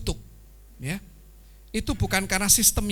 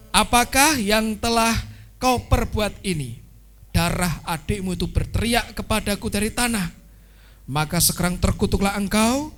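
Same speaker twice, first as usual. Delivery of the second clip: scripture reading; 5 dB louder than usual. Acted. Preacher reads God's curse on Cain, loud and solemn. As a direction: pronounce the curse with weight.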